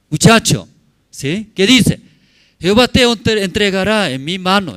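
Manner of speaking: loud, high call to the crowd; preaching shout. Acted. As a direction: lift the voice and call out David's words. (predicador gritando con fuerza)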